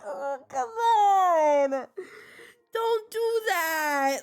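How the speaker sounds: high, very slow, drawn out through laughing tears; begging him to stop, mid cry-laugh; laughing plea. Retold or imitated. Streamer quoting herself to the voice actor, pleading through laughter. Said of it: "And I'm like".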